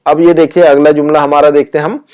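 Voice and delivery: ordinary delivery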